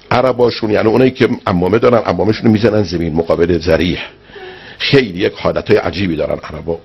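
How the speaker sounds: usual speaking voice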